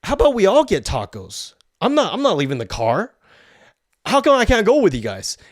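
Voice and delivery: lightly put-on pleading girl voice; mock girl's plea. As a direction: mimic her asking to come along. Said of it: "this girl goes like".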